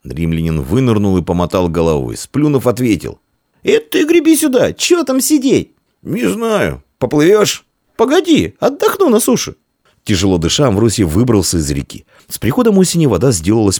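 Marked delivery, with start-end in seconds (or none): 3.6-5.7 very high-pitched holler; calling back. Acted. Swimmer to friend sitting on shore, calling back. 6.0-9.6 very high shouted exchange; calling out. Acted. Tired swimmer and friend on shore, calling out. Boys call between river and shore.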